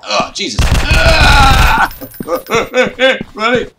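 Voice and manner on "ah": high, loud startled scream; loud high shriek; scream of fright. Real, not acted. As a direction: shriek as he appears. Jump scare.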